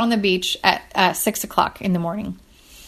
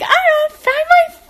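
much higher, slow delighted squeal; giddy excitement; 4 dB louder, slower than usual. Retold or imitated. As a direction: squeal it like a thrilled kid. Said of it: "I'm so excited".

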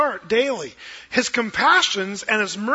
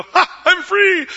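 higher, louder, slow gleeful crow; slow, much higher triumphant ha; gleeful mock; 11 semitones higher, 9 dB louder, slower than usual. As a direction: crow it like a husband let off the hook. Husband skipping off gleeful.

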